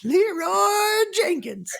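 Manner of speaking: drawn-out, very high war cry; Leroy Jenkins battle cry. Retold or imitated. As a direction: stretch the name out like charging into battle. Famous Leroy Jenkins yell.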